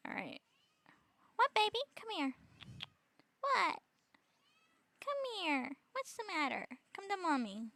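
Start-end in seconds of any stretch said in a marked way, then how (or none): 1.4-7.7 high, sing-song pet talk. Real, not acted. Coaxing pet over, calling mommy.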